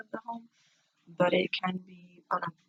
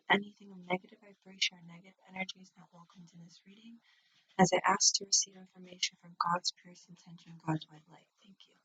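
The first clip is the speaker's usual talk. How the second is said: prayer; 5 dB quieter than usual. Real, not acted. Recites prayer and invocation.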